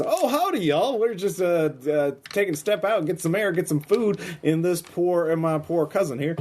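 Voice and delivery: GM playing a menacing man who fakes Texan friendliness, over-the-top Texas accent. GM's bad Texas accent, high and folksy. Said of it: "Your Texas accent is atrocious".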